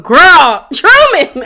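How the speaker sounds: loud, high cry of disbelief; exasperated outburst. Real, not acted. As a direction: call out to the character in exasperation.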